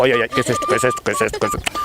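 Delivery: rapid muddled repeated muttering; flustered toad. Acted. Toad bewildered by the fluttering butterfly, flustered babbling. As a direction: babble in comic confusion.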